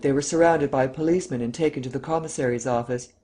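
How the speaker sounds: ordinary delivery, nothing marked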